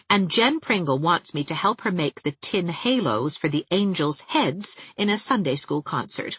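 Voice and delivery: ordinary delivery, nothing marked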